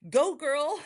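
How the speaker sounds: high, sarcastic cheerleader chant; mock cheer. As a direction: pretend to cheer her on, high. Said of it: "going like".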